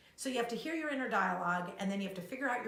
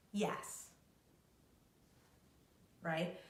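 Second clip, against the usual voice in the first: usual speaking voice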